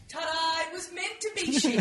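high, showy "Ta-da"; hammed-up triumphant reveal; mock reveal. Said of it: "then it's like".

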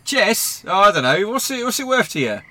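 high, loud jeering; mocking threat. The intruder calling Jeffers in to expose the secret, jeering loudly. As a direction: gloat and threaten to tell everyone.

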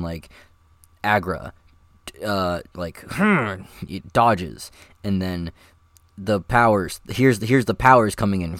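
ordinary delivery

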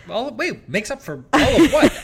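loud, high challenge with a laugh; amused disbelief. Real, not acted. Husband challenging wife's backhanded praise, incredulous.

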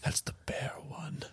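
hushed whisper. Pretend. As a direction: keep it secret, barely breathed. Whispering back.

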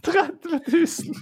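high, slow laughing exclamation. Real, not acted. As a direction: laugh it out in disbelief. Punchline about lost share value.

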